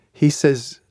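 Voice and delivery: ordinary talk, nothing marked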